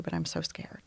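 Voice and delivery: soft frightened whisper; scared whisper. Retold or imitated. To the dispatcher, admitting fear in a whisper.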